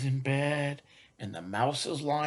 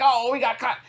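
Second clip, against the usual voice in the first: high, loud startled exclamation; high loud surprised cry; surprised mama; 10 semitones higher, 8 dB louder, faster than usual. Pretend. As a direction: react like you just caught them. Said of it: "she's like".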